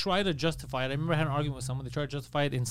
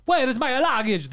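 higher, louder mock Italian up-and-down tune; Italian sing-song; 7 semitones higher, 4 dB louder than usual. Showing how Italians talk, a mock Italian up-and-down tune. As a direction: swing "Wait, it's my luggage" up and down. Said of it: "they'd be like".

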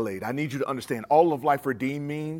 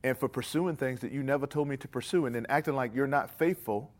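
closing prayer; 2 semitones lower, 4 dB quieter than usual. Acted. Preacher praying over his congregation, quiet and humble. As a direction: pray humbly and softly over the room.

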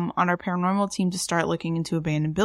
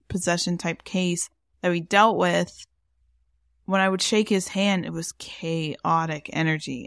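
normal talking voice

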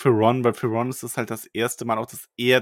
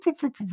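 playful popping sounds; 14 semitones higher than usual. Retold or imitated. Rumour spreading through school, playful popping. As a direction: pop the words like bubbles.

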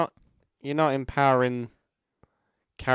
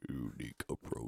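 lowest possible voice, much softer; extremely deep bass voice; 12 semitones lower, 13 dB quieter than usual. Shown as a demonstration. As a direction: sink as deep as the voice goes. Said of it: "Say this in the lowest voice you can".

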